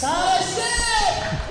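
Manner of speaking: long, high drawn-out name call; dueling hype announcers. Real, not acted. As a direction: stretch the name for the crowd. Announcer calling lifter name.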